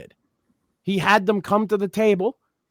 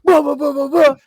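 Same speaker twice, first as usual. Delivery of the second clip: high, loud blustering threat; hammed-up mock threat; 8 semitones higher, 4 dB louder than usual. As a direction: bluster like a street tough. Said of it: "that guy's like".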